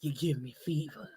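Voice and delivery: low, soft seductive crooning; sultry auntie voice. Retold or imitated. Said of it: "she sound like... like an auntie".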